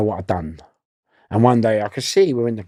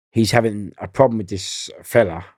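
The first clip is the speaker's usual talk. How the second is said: usual speaking voice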